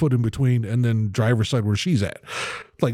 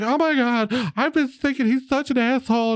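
high emotional gushing imitation; mock-tearful gush, pitched up; hammed-up emotional girl. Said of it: "she got all emotional".

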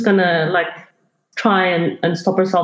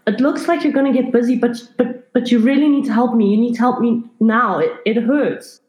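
higher-pitched, urgent plea for help; insistent, hurried begging in a higher voice; pleading; 5 semitones higher, faster than usual. Acted. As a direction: plead like a hurting young patient. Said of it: "she's pleading at the reception desk".

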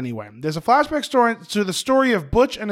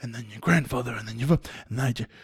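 lightly voiced Walken, low and soft; understated Walken monologue; Christopher Walken impression; 6 semitones lower, 3 dB quieter than usual. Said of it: "in his Christopher Walken accent".

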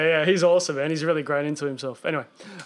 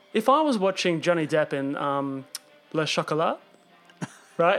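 ordinary talk, nothing marked

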